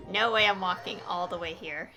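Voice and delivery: loud, exasperated grumble; loud, fed-up gamer groan; loud complaint. Real, not acted. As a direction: protest the long walk out loud. Player balks at long walk.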